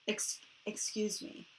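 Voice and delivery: whispered excuse me; prim hushed apology; polite whisper. Retold or imitated. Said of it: "I couldn't be like".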